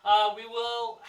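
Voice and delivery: normal talking voice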